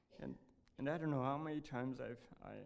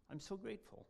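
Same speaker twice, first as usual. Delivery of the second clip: trembling with joy; 8 semitones higher than usual. Retold or imitated. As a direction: voice shaking with happy tears. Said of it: "in a voice trembling with joy".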